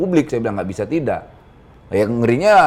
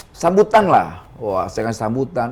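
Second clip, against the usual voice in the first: usual speaking voice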